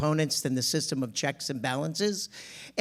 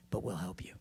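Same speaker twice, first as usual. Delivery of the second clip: whisper; 10 semitones lower, 13 dB quieter than usual. Retold or imitated. Students promising secret help.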